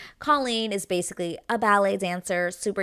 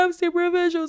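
hammed-up tearful wail, much higher and louder; mock crying; 12 semitones higher, 5 dB louder than usual. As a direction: imitate her bursting into tears. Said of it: "starts crying".

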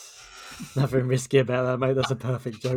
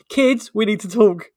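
loud, higher, slow address; announcing punchline; 10 semitones higher, 8 dB louder, slower than usual. Acted. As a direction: call the children over for a talk. Man turns to kids in punchline.

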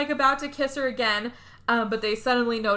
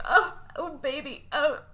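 higher, small lament; mock grief; 3 semitones higher than usual. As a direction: imitate mourning a dead boyfriend. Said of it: "genuinely upset that somebody was dead".

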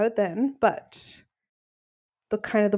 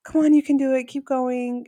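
higher-pitched encouraging cheer; supportive mom; 5 semitones higher, 4 dB louder than usual. Retold or imitated. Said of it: "just being supportive, like a supportive mom".

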